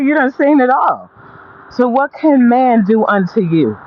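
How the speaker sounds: usual speaking voice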